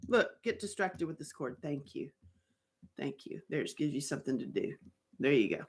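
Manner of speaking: quiet, low soothing coo; soft pet talk. Real, not acted. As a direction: settle the pet down gently.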